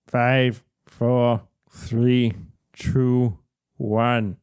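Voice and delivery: low, evenly spaced countdown machine; mechanical announcer. A narrator's reading. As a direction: count down like a launch computer. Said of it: "a mechanical voice announces".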